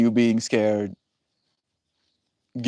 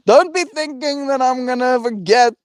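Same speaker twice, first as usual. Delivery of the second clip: high mock threat; 13 semitones higher, 5 dB louder than usual.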